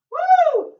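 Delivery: long, very high, loud woo; triumphant whoop. Real, not acted. Trainer finishing the last rep, triumphant whoop.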